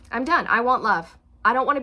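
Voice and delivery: fed-up partner announcing the end. Retold or imitated. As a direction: say it like a final decision. Declaring exit from relationship.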